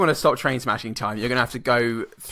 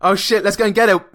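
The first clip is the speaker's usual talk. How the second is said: mock panic; 8 semitones higher, 6 dB louder than usual. Voicing panicked hospital staff.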